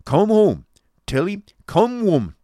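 loud, high, drawn-out command; stern Yorkshire bellow; stern Yorkshireman. Acted. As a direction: order them off in a stern shout. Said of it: "replied the Yorkshireman sternly".

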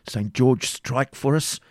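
slow, drawn-out battle invocation; exclamation. A narrator's reading. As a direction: call on the saint with measured fervour. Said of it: "exclaimed the knight".

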